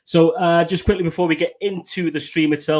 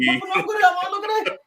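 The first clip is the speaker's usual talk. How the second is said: proud showing off. Real, not acted. Showing off his new shirt.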